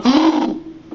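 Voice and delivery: high startled intake of breath; amazed gasp. Acted. Duckling spots the moose across the pond, awed gasp. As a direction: gasp in awe at what you see.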